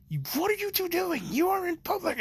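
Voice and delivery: high shrill mom scolding. Retold or imitated. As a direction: scold them like a shocked mother. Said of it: "yelling at them and go like".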